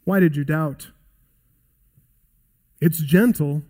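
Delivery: normal talking voice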